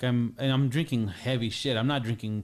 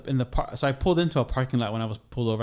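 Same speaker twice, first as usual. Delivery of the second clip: ordinary delivery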